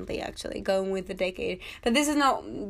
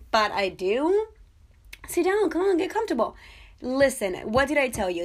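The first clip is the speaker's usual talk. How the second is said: higher-voiced, bubbly hostess greeting guests; gushing party host; 5 semitones higher, 5 dB louder than usual. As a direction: play the over-eager host at her own party. Party host persona again.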